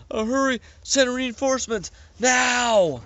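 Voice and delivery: high, frantic call for help; panicked plea. A narrator's reading. Captain on the speaker to headquarters, high and frantic. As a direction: beg headquarters for backup in a panic.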